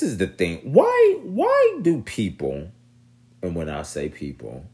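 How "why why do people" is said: slow, high drawn-out why; incredulous squeal at the rioters; exasperated high whine. Real, not acted.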